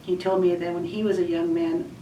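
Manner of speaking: ordinary talk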